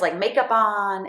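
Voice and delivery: ordinary delivery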